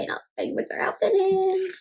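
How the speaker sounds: ordinary delivery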